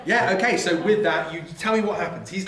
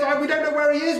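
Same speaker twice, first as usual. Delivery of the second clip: nervous agreement; 9 semitones higher, faster than usual. Acted. Voicing Helen and Jerry.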